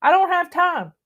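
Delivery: quoted excuse. Retold or imitated. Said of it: "have said".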